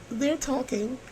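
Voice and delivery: squeaky aside. Acted.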